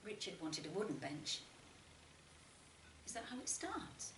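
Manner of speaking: ordinary delivery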